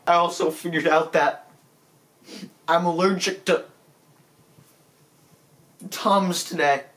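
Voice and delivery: usual speaking voice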